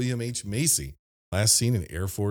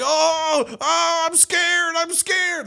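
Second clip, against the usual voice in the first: high, drawn-out imitation of frightened wailing; mock scared kid; 4 dB louder, much slower than usual. Host mimics the parasailing kid slipping through, scared wailing. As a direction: wail it high, hamming up the fear. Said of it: "he's like".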